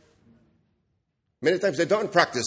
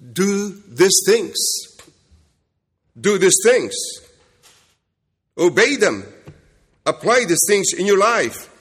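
louder, pressing command; forceful exhortation; 5 semitones higher, 5 dB louder than usual. Acted. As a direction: drive the point home. Preacher exhorting congregation.

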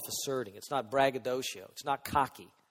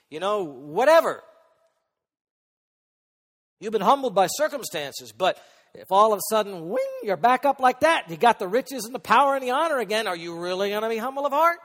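loud pulpit challenge climbing higher; forceful preaching; 5 semitones higher, 11 dB louder than usual. Real, not acted. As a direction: build to a pressing question for the congregation.

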